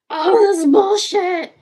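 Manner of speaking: loud, slow venting about the mess; slow loud rant. Real, not acted. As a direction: blast it out slowly in frustration. Venting, building to a loud peak.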